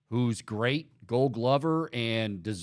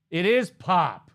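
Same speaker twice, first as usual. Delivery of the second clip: louder, higher, drawn-out retort; mock insistence; 6 semitones higher, 5 dB louder, slower than usual. Host retelling his argument with a Toronto friend, mock insistent. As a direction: insist slowly, playing up the indignation. Retold argument over pop versus soda.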